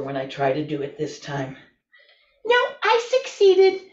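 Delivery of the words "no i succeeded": high, loud shout of success; triumphant cry. Real, not acted. Yoga teacher proving she can still stand up, triumphant shout. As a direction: crow with delight at getting up.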